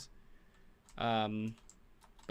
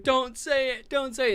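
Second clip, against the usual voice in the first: higher, louder mock plea; a hint of pleading mom, pitched up; lightly voiced mom; 12 semitones higher, 7 dB louder than usual. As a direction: imitate a mom urgently warning. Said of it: "the mom's like".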